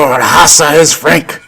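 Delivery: slow, raspy gangster line; lightly imitating a Scarface gangster; Robert Loggia impression. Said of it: "he played Frank, Frank in Scarface".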